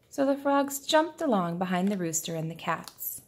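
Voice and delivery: usual speaking voice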